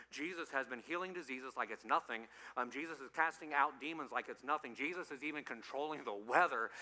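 ordinary talk, nothing marked

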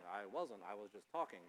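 low, soft, mild protest; calm denial. Retold or imitated. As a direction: insist you were only talking, low and soft. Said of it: "If you wanna hear yelling".

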